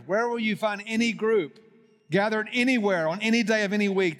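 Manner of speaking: building rhetorical preaching; fervent preaching. Acted. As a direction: preach it rising toward the climax. Rising sermon climax.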